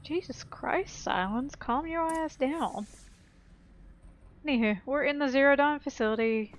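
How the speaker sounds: ordinary delivery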